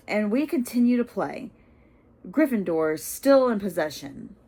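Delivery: sports commentary. Acted. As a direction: commentate with thin sarcasm.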